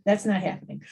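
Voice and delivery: ordinary delivery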